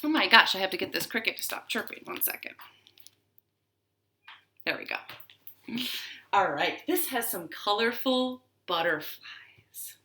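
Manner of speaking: usual speaking voice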